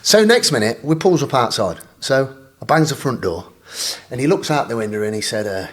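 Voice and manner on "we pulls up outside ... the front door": ordinary talk, nothing marked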